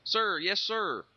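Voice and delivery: high "sir, yes, sir"; recruit voice. Acted. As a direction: snap back like a boot camp private. Drill sergeant recruit game.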